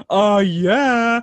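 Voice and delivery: high, loud, gleeful Oh yeah; a triumphant shared look put into words; thrilled. Retold or imitated. Friends realise the singer loves their podcast, gleeful. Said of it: "me and Batch locked eyes like".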